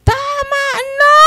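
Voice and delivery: very high, loud screaming demon voice; shrieked possessed plea, dissolving into a wail. Retold or imitated. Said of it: "Sinagot ako niya doon sa likod".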